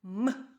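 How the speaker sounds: М hummed briefly, swelling into a loud release; isolated consonant. Shown as a demonstration. Teacher sounds out the last sound, firm loud М. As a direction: push out М alone, firmly. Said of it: "І останній звук вимовте".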